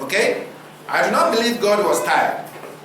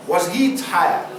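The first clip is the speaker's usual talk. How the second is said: ordinary delivery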